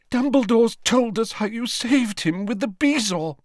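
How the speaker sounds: high, tearful sobbing thanks. A narrator's reading. Mrs Weasley hugs Harry and thanks him, sobbing. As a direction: choke the words out through tears. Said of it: "she sobbed".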